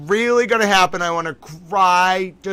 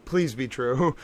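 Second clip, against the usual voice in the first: much softer, lower "please be true", almost to herself; hushed plea; 6 semitones lower, 9 dB quieter than usual. Real, not acted. Streamer hoping the rumor is real, hushed lower plea. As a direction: pray it quietly under the breath. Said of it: "Oh, this is incredible!".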